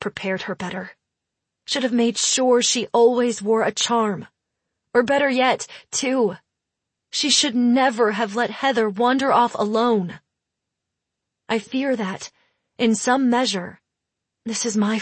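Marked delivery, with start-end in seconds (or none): none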